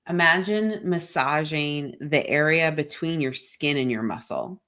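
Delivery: ordinary talk, nothing marked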